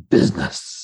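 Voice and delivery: imitating an excited capitalist, low and relishing; Sam. Puppeteer quoting a favourite movie line, low excited relish. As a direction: drop the pitch low and relish the word. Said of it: "having Sam just get all excited about".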